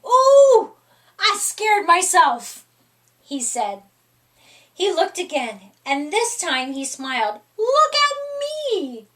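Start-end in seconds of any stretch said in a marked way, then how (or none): 0.1-2.6 high, loud startled squeal at himself. A narrator's reading. Cat startled by his own costume, high squeal. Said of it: "jumped back with a squeal". 7.6-9.1 high excited cry, voice unlike his own. A narrator's reading. Cat showing off his sock legs, high excited cry. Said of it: "he cried".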